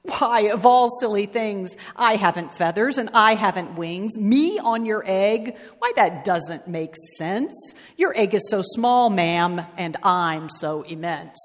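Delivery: plain reading voice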